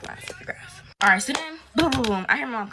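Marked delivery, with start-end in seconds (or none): 0.1-0.8 panicked whisper. Retold or imitated. Panicking, parents up.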